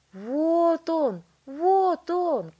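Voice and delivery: high, very loud excited cries; shouting. A narrator's reading. Wonka spotting the boy on screen, shouting. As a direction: shout it out triumphantly. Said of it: "кричал мистер Вонка".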